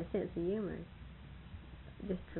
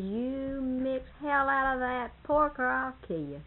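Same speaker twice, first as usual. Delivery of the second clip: slow, menacing boss barking an order; a farmer threatening his helper, slow and gruff; gruff threat; 5 semitones higher, slower than usual. Retold or imitated. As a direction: growl it out like a hard-driving farm boss. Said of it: "That's just the way he talks".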